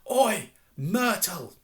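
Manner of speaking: high, drawn-out loud call; bellow. A narrator's reading. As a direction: call out at full voice, stretching each word. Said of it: "took a deep breath and bellowed".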